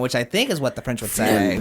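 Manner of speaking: ordinary talk, nothing marked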